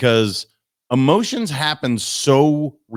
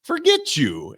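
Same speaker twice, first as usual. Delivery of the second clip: high-pitched, huffy comeback; exaggerated, offended brush-off; mock indignant retort. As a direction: imitate snapping back at someone, much higher. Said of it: "I was ready to go".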